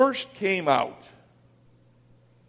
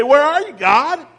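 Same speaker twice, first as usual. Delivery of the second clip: higher, louder demand to God; exasperated cry; 8 semitones higher, 7 dB louder than usual. Retold or imitated. Believers crying out to God, higher and louder. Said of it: "we say".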